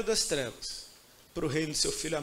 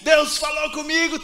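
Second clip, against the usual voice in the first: excited retelling; 6 semitones higher, 10 dB louder than usual. Retold or imitated. Excitedly telling the pastor.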